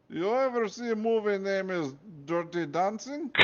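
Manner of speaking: high, curious Russian guard; Russian accent. Acted. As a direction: ask eagerly in a Russian accent. Nikolai asks about movie.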